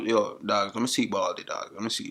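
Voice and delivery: ordinary talk, nothing marked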